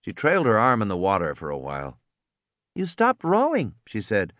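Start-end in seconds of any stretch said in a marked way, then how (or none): 2.7-3.8 put-on girl's voice. A narrator's reading. Lex's girl voice.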